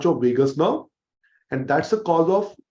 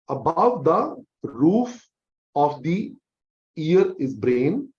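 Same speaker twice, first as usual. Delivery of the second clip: slow, each word spaced out; slow emphatic drilling; much slower than usual. Shown as a demonstration. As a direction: spell it out slowly for students. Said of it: "teacher slows to drill".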